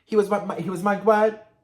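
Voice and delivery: high, loud incredulous outburst; shocked reaction. Retold or imitated. Vlogger mimicking her reaction to hearing he was mugged, shocked. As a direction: blurt it out in disbelief. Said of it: "please comment your reaction right now".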